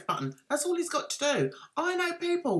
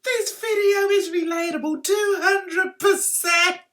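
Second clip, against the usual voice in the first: gushing commenter; 7 semitones higher, 7 dB louder than usual. Pretend.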